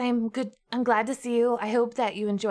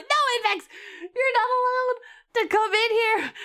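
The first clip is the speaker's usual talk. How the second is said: over-the-top pretend distress; mock protest; 12 semitones higher, slower than usual. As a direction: dramatically forbid a chatter entering. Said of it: "over-exaggerating for effect".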